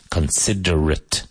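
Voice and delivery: Considerate ending in a short "-it"; correct reduced ending. Shown as a demonstration. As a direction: say Considerate slowly as con-SID-er-it. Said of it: "It's pronounced considerate".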